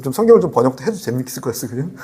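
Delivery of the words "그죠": ordinary delivery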